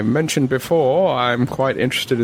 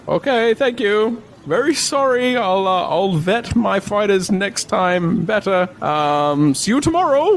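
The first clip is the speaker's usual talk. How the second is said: put-on high, wavering old merchant apologizing; old man voice; 10 semitones higher than usual. Old man apologizing to the adventurers, high and flustered. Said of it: "he goes".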